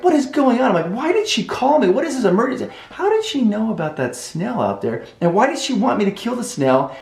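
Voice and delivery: high-pitched frantic questions to yourself; panicked racing thoughts. Retold or imitated. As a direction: fire off bewildered questions, high. Panicked thoughts racing in his head.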